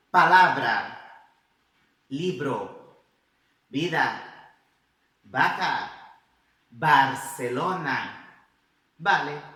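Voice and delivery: "Vida", "Vaca" and "Vale" said with a B sound, words spoken loud and clear; "Libro" and "Barcelona" in slow, loud Spanish, with B. Shown as a demonstration.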